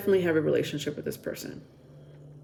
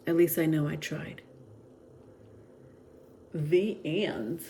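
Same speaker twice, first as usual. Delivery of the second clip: channeled message reading; 5 dB quieter than usual. Acted. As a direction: read it like a heartfelt love letter. Reads the channeled love message.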